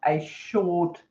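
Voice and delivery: slow, deliberate classroom question; slow teacher prompt. Shown as a demonstration. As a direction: draw the question out for kids. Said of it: "So you can say".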